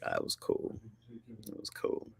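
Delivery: hushed, voiceless murmur to oneself; soft retold whisper. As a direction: whisper it beside a sleeping child. Beside the child falling asleep.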